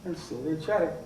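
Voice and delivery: low, soft aside; hushed disbelief. A narrator's reading. Murderer watching the men keep chatting, hushed disbelief. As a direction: drop suddenly to a low murmur.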